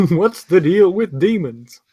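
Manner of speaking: Jerry Seinfeld Illidan. Retold or imitated. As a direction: do Illidan as a standup bit. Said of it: "Jerry Seinfeld voice Illidan".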